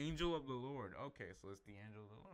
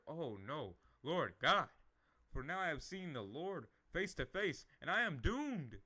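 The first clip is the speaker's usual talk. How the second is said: louder and higher, panicked; terrified outcry; 4 semitones higher, 9 dB louder than usual. Acted. Gideon realizing he saw the Lord, crying out in loud panic. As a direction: cry out as if doom has come.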